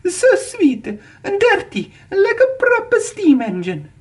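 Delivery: very high, slow gushing; a diva gushing over a little green engine; delighted exclaiming. A narrator's reading. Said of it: "Alicia Botti exclaimed".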